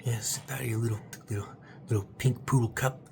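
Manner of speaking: usual speaking voice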